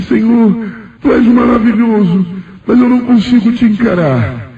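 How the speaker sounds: retold weeping plea, pitched very high; high, overwhelmed cry of adoration; tearful awe. Believer cannot face the Lord's radiance, high tearful re-enactment. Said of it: "eu comecei a chorar e disse".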